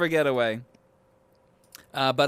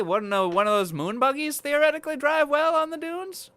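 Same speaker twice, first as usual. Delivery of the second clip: high mock voice; 12 semitones higher than usual. Voicing writers, pitch jumps high.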